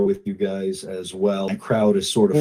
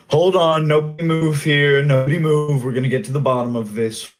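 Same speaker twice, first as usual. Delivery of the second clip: raised, commanding call easing off; shouting out; 5 semitones higher than usual. Acted. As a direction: call a crowd to a halt, then settle. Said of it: "he just shouts out".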